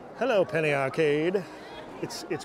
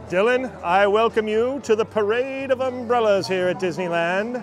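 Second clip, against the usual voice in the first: higher, louder showman announcer; 4 semitones higher, 5 dB louder than usual. Pretend. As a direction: boom it out like a ringmaster. Grand mock welcome to umbrellas.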